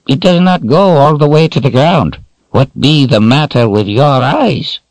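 high raspy crone voice; raspy old woman. A narrator's reading. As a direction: rasp it out like an old witch. Narrator voices Addie.

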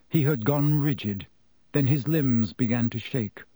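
usual speaking voice

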